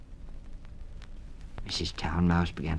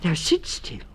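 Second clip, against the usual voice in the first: character voice; 13 semitones higher than usual. Acted. Town Mouse character voice.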